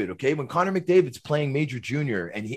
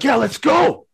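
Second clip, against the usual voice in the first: eager challenge; 12 semitones higher, 6 dB louder, slower than usual. Retold or imitated. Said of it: "he's like".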